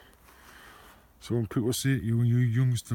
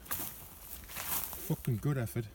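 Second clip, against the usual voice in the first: slow, drawn-out whispered sarcasm; sarcastic whisper; 8 dB quieter, much slower than usual. Real, not acted. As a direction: breathe it out slowly, disgusted. Sarcastic praise of litter found.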